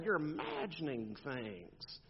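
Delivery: slow, softened mock reassurance; mock parental dismissal. Parent to a crying kid, slow and soft mock dismissal. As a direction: draw it out softly, dismissing the complaint.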